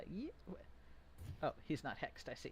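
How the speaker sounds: ordinary talk, nothing marked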